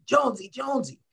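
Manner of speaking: high, strained coach's yell; mock yell of a fired-up coach; imitating coach yelling. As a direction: call his name like an angry coach. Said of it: "is yelling at me".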